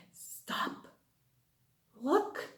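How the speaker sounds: soft hushed whisper. Shown as a demonstration. Teacher whispering to children before a listening game. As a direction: whisper it so they lean in to listen. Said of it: "teacher whispers listening cue".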